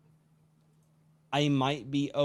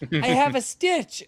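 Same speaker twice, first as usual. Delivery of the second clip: put-on child voice; 11 semitones higher, 7 dB louder than usual. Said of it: "And she goes".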